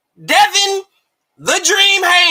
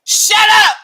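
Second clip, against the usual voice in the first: loud shut up; 6 semitones higher, 4 dB louder than usual. Real, not acted. Scolding Teofimo, loud and high.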